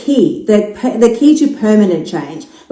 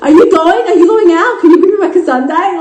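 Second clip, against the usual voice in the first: higher, louder, pleading for a sundae; mock eager plea; 8 semitones higher, 3 dB louder than usual. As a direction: beg sweetly and quickly.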